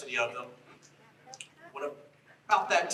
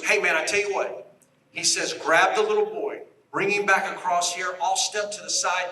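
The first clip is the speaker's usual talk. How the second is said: retold call; 5 semitones higher, 6 dB louder than usual. Agent calls across the river.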